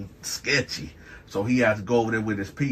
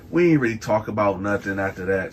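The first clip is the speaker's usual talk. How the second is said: ordinary talk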